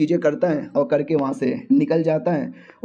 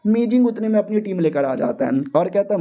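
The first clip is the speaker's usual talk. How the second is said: ordinary delivery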